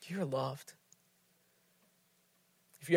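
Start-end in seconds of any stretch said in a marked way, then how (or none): 0.0-0.7 soft, slow, much quieter; tender reassurance. Acted. God the Father telling a hurting person, softly and tenderly. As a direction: speak it like a father to a hurting child. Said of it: "will look at you and say".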